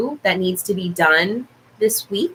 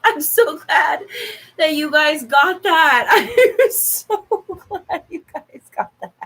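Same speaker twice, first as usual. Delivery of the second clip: high, teary laugh-talk; overjoyed and laughing; giggling happily while talking; laughing through tears; 6 semitones higher than usual. Real, not acted.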